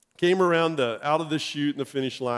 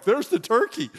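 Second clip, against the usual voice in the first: excited spotting; 10 semitones higher, 4 dB louder than usual. Retold or imitated. Spotting the turkey mid-race.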